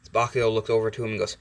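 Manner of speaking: plain reading voice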